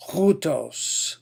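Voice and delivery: hutos with a rough H onset; hutos, H breathed out before the vowel; rough H sound. Shown as a demonstration. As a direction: push air into the H of hutos. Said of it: "Give it a, a rough H sound".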